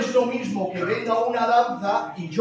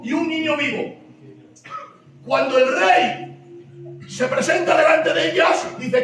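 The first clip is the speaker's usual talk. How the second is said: building storytelling; 6 semitones higher than usual. A narrator's reading. Preacher telling the Solomon story, rising excitement.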